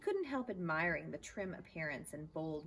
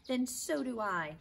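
louder, eager loyal cry; 7 semitones higher, 7 dB louder than usual. Acted. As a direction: cry it out to back up your friend. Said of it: "cried Stuart loyally".

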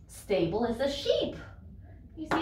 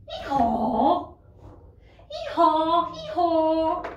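donkey bray; 5 semitones higher, 5 dB louder, slower than usual. Shown as a demonstration. Imitating a donkey.

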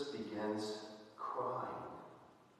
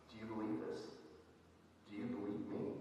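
gentle question; 9 dB quieter, faster than usual. Acted. Jesus asks her personally.

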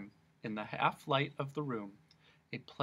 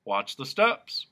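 advising; 2 semitones higher, 8 dB louder, slower than usual. Acted. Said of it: "advised the policeman".

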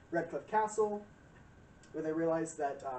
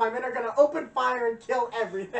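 higher, louder commander threat; hammed-up knight commander; mock bellow; 6 semitones higher, 9 dB louder than usual. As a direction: bark orders like a hothead. Gregor voiced, loud.

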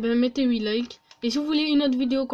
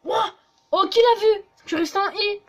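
excited; 6 semitones higher, 6 dB louder, faster than usual. Real, not acted. Celebrating the stunt.

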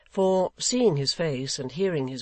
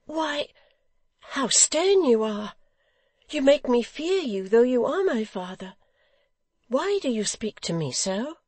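high, frightened innocent; alarmed, childlike high voice; terrified amazement; 10 semitones higher, 4 dB louder than usual. A narrator's reading. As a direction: plead high-pitched, shrinking back. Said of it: "a look of terrified amazement".

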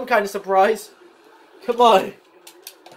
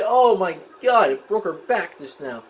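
ordinary delivery

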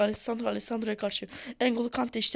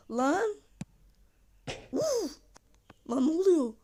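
rising alarm; 8 semitones higher, slower than usual. Real, not acted. Gamer panicking as his player nearly scores an own goal. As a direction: build from alarm to disbelief.

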